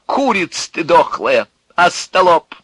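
high, loud defiant tirade; mocking outburst, sliding into insults; exclaiming. A narrator's reading. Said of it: "воскликнул он".

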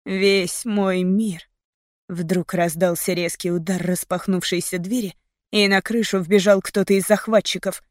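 ordinary delivery, nothing marked

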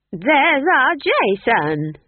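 loud, high, drawn-out happy call; proud mother calling out, stretching each word; Nanny happily crowing over family. A narrator's reading. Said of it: "said Nanny happily".

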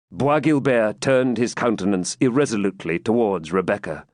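ordinary delivery

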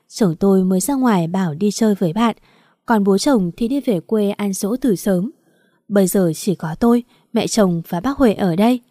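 usual speaking voice

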